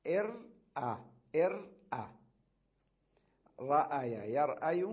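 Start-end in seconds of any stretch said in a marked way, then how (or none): 0.1-2.1 إر then أ, said higher and louder; sounding out. Shown as a demonstration. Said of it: "إيش". (تقطيع إر أ بصوت أعلى)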